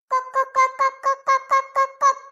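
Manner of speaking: hen call. Acted. Calling for her lost hen.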